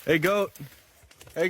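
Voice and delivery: normal talking voice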